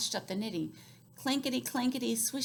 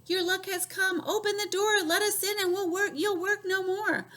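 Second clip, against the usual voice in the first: high fairy voices; 10 semitones higher, 5 dB louder than usual. Acted.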